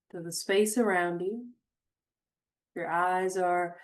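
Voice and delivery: unhurried meditation voice with long pauses; guided meditation. Acted. Host guiding listeners to notice their surroundings, guided meditation. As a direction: guide them gently, phrase by phrase, leaving space.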